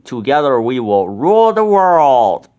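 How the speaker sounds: loud gloating villain voice; villain proclamation. A narrator's reading. Ben gloats to the captured crowd, loud villain proclamation. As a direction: gloat over the world you'll rule.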